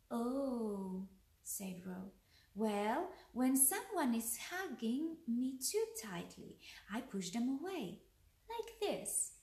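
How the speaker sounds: lively bedtime-tale narration; story read to children. A narrator's reading. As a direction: read a picture book aloud, pausing between lines.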